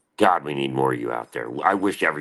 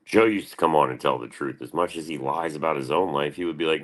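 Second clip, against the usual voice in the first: ordinary delivery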